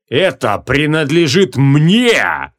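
very slow, drawn-out possessive roar; deafening roar. A narrator's reading. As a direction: roar each word, swelling on the last. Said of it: "оглушающий рёв". (протяжный грозный рёв)

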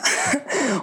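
a short high burst of laughter. Real, not acted. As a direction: laugh at the absurdity. Rire après la citation. (petit rire)

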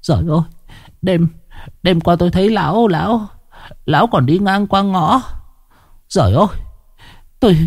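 high-pitched woman stumbling over repeated words; hushed, stammering dismay. A narrator's reading. Village woman reacts to seeing the corpse, stammering in dismay. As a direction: stammer it out, high and rushed in places. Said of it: "Một mụ đàn bà thì thào".

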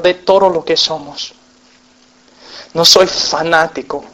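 earnest closing prayer to the Father; pastor's closing prayer; spoken prayer. Acted. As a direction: pray it sincerely, steady.

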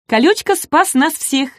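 high, eager little voice; small dinosaur bragging of his hero; proud exclamation. A narrator's reading. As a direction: pipe up proudly for your friend. Shishka proudly praises his rescuer.

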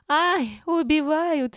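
very high, loud cry for help; alarmed yell. A narrator's reading. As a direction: wail out as if attacked. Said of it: "заорала Таня своим голосом".

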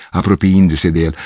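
ordinary delivery

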